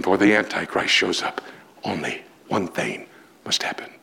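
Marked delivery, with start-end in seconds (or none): 1.8-3.9 whispered, hushed build-up; dramatic whisper. Acted. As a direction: drop to a whisper before the reveal. Dramatic build before climax.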